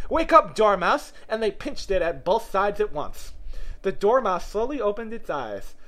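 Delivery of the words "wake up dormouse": high, loud drawn-out call. A narrator's reading. Both friends waking the Dormouse for a story, calling out. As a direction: rouse a sleeper with a cry. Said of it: "they both cried".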